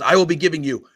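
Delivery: blustering threat over comms; angry captain. Acted. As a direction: lay down a deadline like an irate commander. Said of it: "He says".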